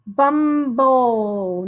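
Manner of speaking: "bumble" joined slowly with a long second syllable; blending syllables. Shown as a demonstration. Teacher puts both syllables together for pupils, blending. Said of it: "then we sound out the second syllable".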